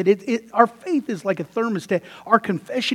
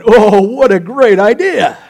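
loud, slow, delighted mock approval; gleeful husbands; 11 dB louder, slower than usual. Pastor mimics husbands cheering the dummy thermostat, loud and slow. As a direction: ham up the husbands' glee at the idea. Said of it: "some of you husbands are going".

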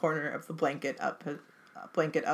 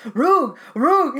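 loud high barking Roog; dog's bark cry; 10 semitones higher, 11 dB louder than usual. Acted. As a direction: bark it like a frantic dog. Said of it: "he cried".

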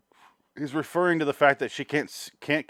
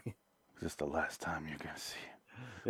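low, hushed mock-solemn husband; mock grave; 7 semitones lower, 9 dB quieter than usual. Imitating the film's husband at the viewing room, mock solemn. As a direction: lower it into a grave put-on voice. Acting Nick Cage's grave line.

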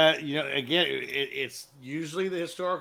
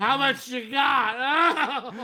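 high, loud, slow, through laughter; laughing mock question; 12 semitones higher, 9 dB louder, much slower than usual. Echoing the crazy buyer's offer, laughing loudly and slowly. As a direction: stretch it out while cracking up.

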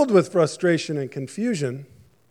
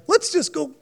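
much higher, sneering bully voice; 12 semitones higher than usual. Pretend. Bullies on the beach heading for the kids' sandcastle, sneering. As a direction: egg your friends on to wreck it. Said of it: "you picture these bullies".

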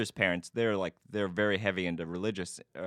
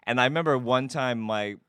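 normal talking voice

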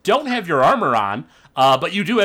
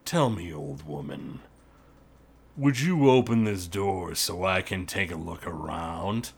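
gruff orc boss; 8 semitones lower, 7 dB quieter than usual. Acted. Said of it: "the gruff voice of Lockgrim".

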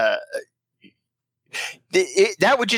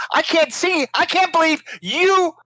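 high-pitched, laughing indignation; mock disbelief; 7 semitones higher than usual. Host scolding caller over gluten-free, mock disbelief.

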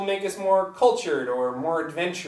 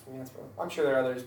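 ordinary talk, nothing marked

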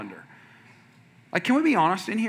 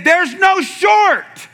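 laughing, drawn-out, higher and louder protest; laughing outburst; 11 semitones higher, 7 dB louder, much slower than usual. Retold or imitated. Pastor reliving his shock at the running store, laughing shout. Outraged at tiny running shorts.

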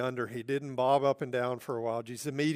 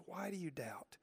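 much softer, gentle Why do you doubt?; hushed question; 2 semitones higher, 13 dB quieter than usual. Acted. Jesus tenderly asking Peter again, hushed. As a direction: let the question fall to near a whisper.

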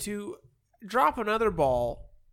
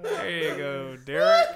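laughing; 2 semitones lower than usual. Real, not acted. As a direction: giggle out the name. Laughing hard after Mad Lib.

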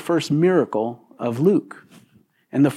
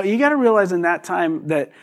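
ordinary talk

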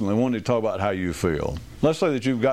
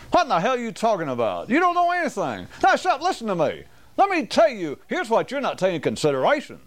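raised, much higher, exasperated rant; mock heated argument; 10 semitones higher than usual. Mimicking people arguing sports and religion, heated raised voice. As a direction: play a know-it-all shouting down the other side. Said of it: "and they'll argue".

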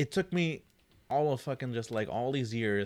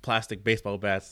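ordinary talk, nothing marked

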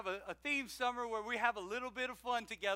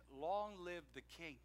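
respectful reply; 5 semitones lower, 9 dB quieter, slower than usual. Retold or imitated. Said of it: "he responded".

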